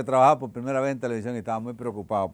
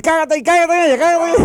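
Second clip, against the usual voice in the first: very high, loud screeching shout; yelling at pigeon; 17 dB louder than usual. Acted. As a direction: scream at the pigeon to shut up. Statue yells at pigeon.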